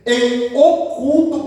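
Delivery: ordinary talk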